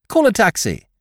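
rally the valet to action; urgent young master. A narrator's reading. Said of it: "Bertie giving orders".

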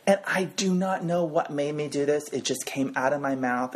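ordinary delivery

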